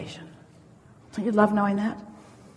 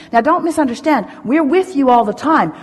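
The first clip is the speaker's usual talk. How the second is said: usual speaking voice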